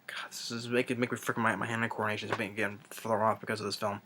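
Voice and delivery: whispering through a migraine; hushed whisper. Real, not acted. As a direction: keep it low and hushed, nursing a headache. Headache, voice drops to near whisper.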